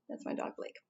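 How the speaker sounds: soft, barely voiced aside; hushed aside. Real, not acted. Presenter explaining a dog's noise to viewers, hushed aside. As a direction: slip it in under your breath. Quiet aside about the dog.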